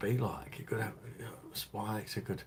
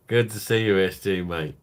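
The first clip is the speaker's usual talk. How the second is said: loud mock greeting; 9 dB louder than usual. Greeting imagined character.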